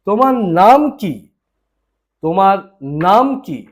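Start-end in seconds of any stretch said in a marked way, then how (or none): none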